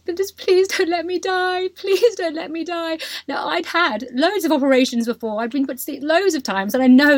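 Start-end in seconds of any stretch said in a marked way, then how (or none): 0.0-3.0 higher, pleading please don't let me die; scared plea to the Turkish staff; frightened plea. Retold or imitated. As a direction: beg not to die before surgery. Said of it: "And I just said".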